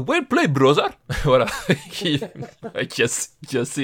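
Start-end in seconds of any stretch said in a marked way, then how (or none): 0.1-0.9 high, loud mock Balkan accent; impossible Balkan-style accent. Fan imitating the actor's film role, hammed-up Balkan accent. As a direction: ham up an improbable Balkan accent. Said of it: "il te sort un accent pas possible".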